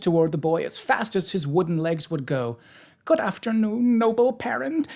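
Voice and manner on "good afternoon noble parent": high-pitched, delighted greeting; delighted cry. A narrator's reading. Pumpkinhead greeting his noble parent, delighted. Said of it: "he cried delightedly".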